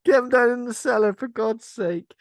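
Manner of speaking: hammed-up fussy pensioner ordering the chocolates away; old couple voiced by a podcaster, a loud high mock bark; mock old person. Voicing the old couple about chocolates.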